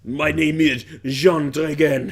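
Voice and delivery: slow, hammed-up French accent; mock French accent. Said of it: "French accents literally don't sound like that".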